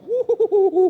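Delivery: loud, high "wuhuhu"; big comic hooting cheer; gleeful whoop. Acted. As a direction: whoop with mock delight over a windfall. Delighted noise at hiding thick envelope.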